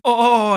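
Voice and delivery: loud, high drawn-out "oh"; mock climax moan. Clown pretending to climax onstage, mock moan. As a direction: imitate an exaggerated orgasmic cry. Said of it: "vocalizes coming".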